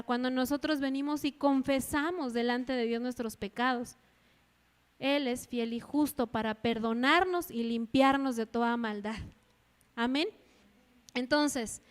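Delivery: ordinary delivery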